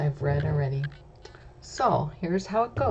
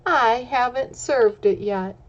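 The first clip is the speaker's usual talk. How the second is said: louder scolding sister bear; 3 semitones higher, 8 dB louder than usual. Acted. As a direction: shush the silly bears. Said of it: "Sister Bear says".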